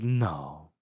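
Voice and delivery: low refusal. Acted. He answers whether she wants worse, low refusal. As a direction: drop deep for one short answer. Retold character's short refusal.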